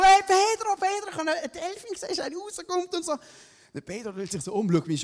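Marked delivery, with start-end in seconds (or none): 0.0-3.2 high, fast excited call across the water; calling out excitedly. Retold or imitated. Surfer calling to flatmate across the waves, high fast shout. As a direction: yell to your friend far away. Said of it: "ich drülle mich so".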